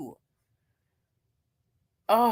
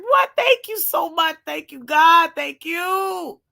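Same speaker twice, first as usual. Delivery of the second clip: high, loud burst of gratitude; re-enacted grateful delight; overjoyed thanks; 6 semitones higher, 6 dB louder than usual. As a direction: exult in thanks for a windfall. Retells thanking God for money.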